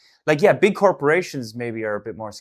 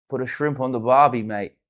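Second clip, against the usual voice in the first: put-on cliched Aussie line, lightly accented; 5 dB louder than usual. Streamer plays stock Aussie for Australian viewer, light accent. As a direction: toss off a stock Aussie phrase. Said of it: "That's it".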